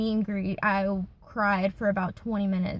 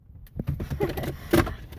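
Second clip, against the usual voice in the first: giggle; 8 semitones higher than usual. Real, not acted. Laughs at own joke.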